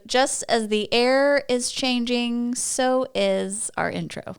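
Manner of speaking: slow, deliberate teacher announcement; measured, put-on classroom delivery. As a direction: draw it out like a teacher addressing the class. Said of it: "a little bit of your teacher voice".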